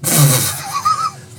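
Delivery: shrieking laugh well up high; a sudden high laugh; real squealing laugh; high squeal of laughter. Real, not acted.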